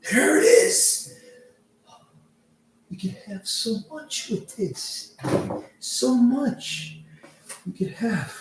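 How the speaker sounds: high, slow greedy dwarf voice fading into an eager hiss. Acted. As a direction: drool over the treasure, slowly. Dwarf greedy over pouch.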